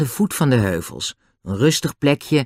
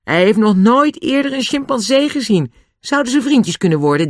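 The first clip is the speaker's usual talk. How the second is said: high-pitched call to someone; raised, higher voice, calling across; 10 semitones higher, 4 dB louder than usual. A narrator's reading. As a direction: call it over to him. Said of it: "George riep naar Nobby".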